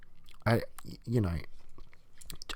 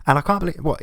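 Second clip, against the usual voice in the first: ordinary delivery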